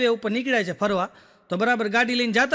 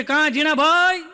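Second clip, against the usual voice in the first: put-on loud, slow, higher call to an old friend; hearty hail; 5 semitones higher, 4 dB louder, slower than usual. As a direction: call out like you know him. Stranger hails after a punch.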